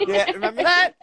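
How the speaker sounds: excited. Real, not acted. Excited nostalgia.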